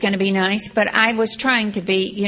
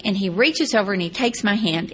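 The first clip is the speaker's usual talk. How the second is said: ordinary talk, nothing marked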